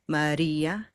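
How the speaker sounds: ordinary talk, nothing marked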